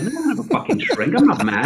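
high-pitched exasperated denial, a touch of mock outrage; mock indignant retort. Recalling brushing off Jason on the fire escape, mock-indignant and high. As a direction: play up the offended protest to your friend, fast and high.

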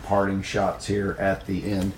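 ordinary talk, nothing marked

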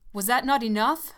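loud fierce demand. Acted. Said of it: "he demanded fiercely".

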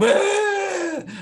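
high, loud shriek of laughter; wild whooping laugh. Real, not acted. Laughing shout at joke.

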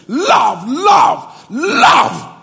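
loud, rising angry repetition of love; the preacher flares up at his congregation, angry; angry cry of love. Real, not acted. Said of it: "You know why I'm getting angry now?".

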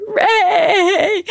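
high, loud, tearful plea; crying out. Retold or imitated. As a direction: sob his name right in his ear. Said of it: "I'm crying in his ear".

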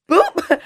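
high little boop noise; imitated "boop". Woman miming the doctor's exam, high boop. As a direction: pop out a quick, high boop.

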